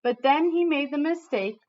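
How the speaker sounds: lively read-aloud storytelling voice; reading to children. Acted. Teacher reading a picture book to her class, lively storytelling.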